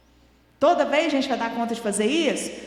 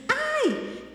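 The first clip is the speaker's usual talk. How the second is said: sudden high "Ai!" of pain; imitated child's yelp; 13 semitones higher than usual. Mother imitating her son's cry from the electric shock, high yelp. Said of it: "quando ele fez assim".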